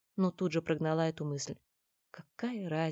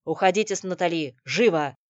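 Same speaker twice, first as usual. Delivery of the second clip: loud order brooking no objection; commanding; 9 dB louder than usual. A narrator's reading. Корецкий sends Никита and Натали away, commanding. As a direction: order them off, allow no argument. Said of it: "голосом, нетерпящим возражения, приказал".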